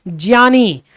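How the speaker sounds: ज्ञानी said as "jyani"; slow j-plus-y start on ज्ञानी; correct pronunciation demo. Shown as a demonstration. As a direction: sound ज्ञानी out with "jya". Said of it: "ज और इया से मिलकर".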